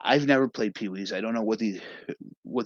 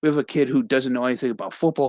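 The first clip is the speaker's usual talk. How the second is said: normal talking voice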